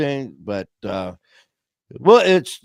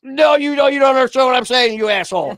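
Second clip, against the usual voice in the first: higher and louder, fast angry snap; angry retort; 9 semitones higher, 6 dB louder, faster than usual. Retold or imitated. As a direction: tell the bot off, fed up. Yelling at phone bot.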